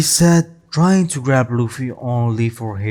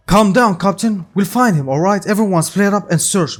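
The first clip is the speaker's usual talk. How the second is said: raised, higher rallying voice; taking charge; 8 semitones higher, 5 dB louder than usual. A narrator's reading. Ben orders the crew to search, taking charge. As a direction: steer the crew with loud orders.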